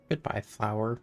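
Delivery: normal talking voice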